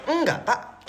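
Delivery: high-pitched Madurese-accented Indonesian, falling sharply. Shown as a demonstration. Madurese villager speaking Indonesian to "Pak", Madurese accent. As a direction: imitate a thick rural Madurese accent. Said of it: "kalau orang Madura ngomong bahasa Indonesia tuh kayak".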